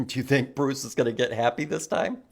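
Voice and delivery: ordinary delivery, nothing marked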